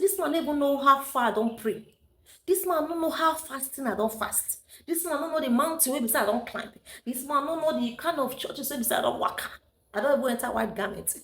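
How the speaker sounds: re-enacting a heated indignant complaint; indignant rant. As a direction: vent it like you can't believe his nerve. Retold outburst about the boy.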